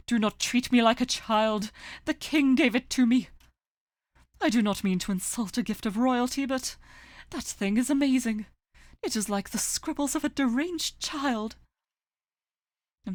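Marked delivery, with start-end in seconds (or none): 0.1-11.6 indignant rant. Acted. Sakuya offended, ranting.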